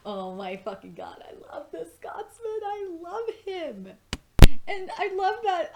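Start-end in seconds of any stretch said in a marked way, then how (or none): 1.2-5.7 high, squealing adoration; gushing. Real, not acted. Reviewer gushing over the Scotsman, squealing with love. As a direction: gush over him like a fan.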